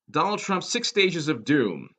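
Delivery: ordinary talk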